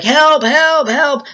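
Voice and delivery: high-pitched mock distress call; feigned cries for help. Vet faking a dog attack, high mock cries for help.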